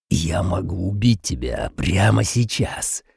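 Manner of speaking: slow, menacing hissed threat; furious hiss. Acted. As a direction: hiss the threat through fury. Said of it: "яростно прошипел Саймак".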